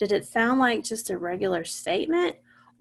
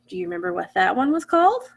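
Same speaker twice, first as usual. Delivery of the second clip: normal talking voice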